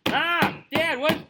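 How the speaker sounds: put-on high, loud kid's yell; child calling Dad. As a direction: call like a pestering kid at the door. Child calling at the bathroom door.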